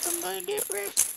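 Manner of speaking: very high squeaky panic. Acted. Repeats the line in new voice.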